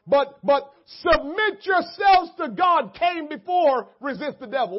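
loud, slowed emphasis; preaching shout. Real, not acted. Preacher pressing the order of the verses, loud. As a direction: press it on them.

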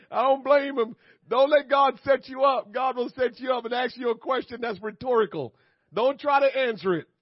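loud, high preacher's warning; animated preaching. Real, not acted. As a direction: warn the congregation at full voice.

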